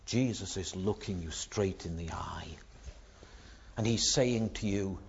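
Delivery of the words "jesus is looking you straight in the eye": soft unvoiced whisper; confiding whisper, eye to eye; hushed whisper. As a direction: lean in and whisper it close. Intimate turn to the listener.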